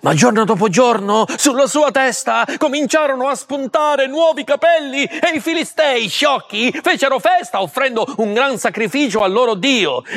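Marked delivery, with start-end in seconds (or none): none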